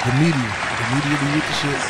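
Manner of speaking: calling out over the clip; emphatic loud comment; loud exclamation. Real, not acted.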